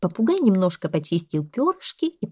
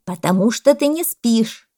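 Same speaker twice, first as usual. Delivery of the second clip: loud, higher parrot retort; indignant parrot; 5 semitones higher, 7 dB louder, slower than usual. A narrator's reading. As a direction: insist loudly and indignantly. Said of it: "Зато возмутился попугай". (попугай, возмущённо и громко)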